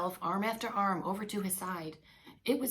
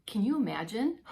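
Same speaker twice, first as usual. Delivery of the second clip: ordinary delivery, nothing marked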